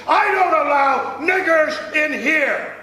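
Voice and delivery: a hostile driver barking a refusal; fiendish driver. Retold or imitated. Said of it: "I was told by the driver".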